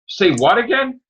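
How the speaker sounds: high, stunned yelp of disbelief; retold disbelief. Hearing the news at work, high shocked disbelief. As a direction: pitch shoots up in shock. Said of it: "I was at work and I'm like".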